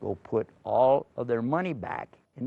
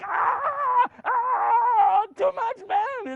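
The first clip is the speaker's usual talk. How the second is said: high, loud overjoyed cries; joyful crying out; 5 dB louder, much slower than usual. Acted. Brothers hugging after finding Yusuf, crying out high with joy. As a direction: wail with joy at the reunion.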